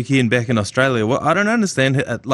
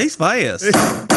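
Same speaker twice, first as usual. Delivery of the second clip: high-pitched parting echo; mimicking the bloke; 7 semitones higher than usual. Host capping the running gag, high mimicking voice. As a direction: copy his line once more, pitched high. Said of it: "one of the blokes trying to talk about him".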